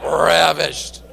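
RAVISHED stretched out with a long, lingering R; low, savoring RAVISHED; relished RAVISHED. Shown as a demonstration. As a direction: ham up RAVISHED for a laugh. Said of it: "if you don't say it like that".

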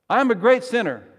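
loud, high-pitched line for response; forceful confession, projected high; emphatic call. Acted. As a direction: call it out for the congregation to answer. Leading congregation in call and response.

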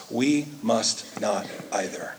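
whisper. Real, not acted. Closing point dropped to whisper.

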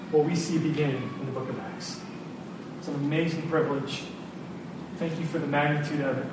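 soft, earnest prayer; closing prayer. Acted. Pastor prays for the congregation, soft and earnest. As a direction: pray quietly over the church.

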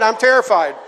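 usual speaking voice

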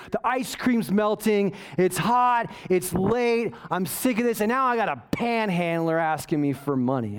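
usual speaking voice

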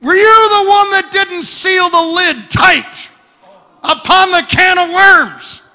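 high, loud shrill-wife imitation; wife yelling. Preacher imitating his wife yelling about the worms in the fridge, high and loud. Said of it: "She screamed".

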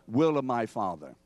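ordinary talk

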